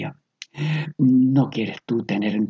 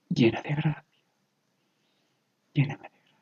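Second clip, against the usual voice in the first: guided prayer meditation; 4 semitones higher than usual. Acted. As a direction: lead them into prayer, one word at a time. Leads a slow guided prayer meditation.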